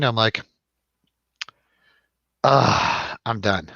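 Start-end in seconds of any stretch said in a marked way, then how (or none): none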